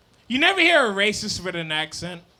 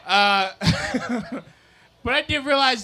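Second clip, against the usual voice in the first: ordinary talk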